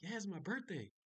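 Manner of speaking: mock enthusiastic. Maintenance man trying to match the tone, enthusiastic. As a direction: play up the excitement, pitch soaring. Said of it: "he tried to match my tone".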